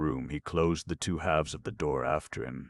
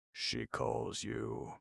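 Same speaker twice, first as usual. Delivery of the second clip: slow whisper; a slow, hushed whisper; 5 semitones higher, 7 dB quieter, much slower than usual. A narrator's reading. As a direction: breathe it out slowly, barely voiced. Whispered aside.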